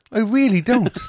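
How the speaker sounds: really don't, totally lost; baffled. Retold or imitated. Manager retelling customer complaint, high bafflement. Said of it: "And I was like".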